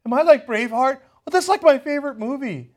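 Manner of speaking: mocking the spokeswoman as delighted and oblivious; clueless Pearson. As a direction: play her as a flattered airhead. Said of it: "She's like".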